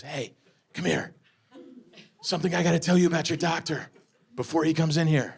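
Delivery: hushed, low nurse imitation; retold whisper. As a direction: lean in confidentially, keeping it soft and low. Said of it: "whispers to me".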